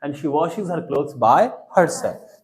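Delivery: very slow, word by word; teacher to class: spell it out slowly for repeating; slow model sentence. Shown as a demonstration. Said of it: "So बोल के देखिए".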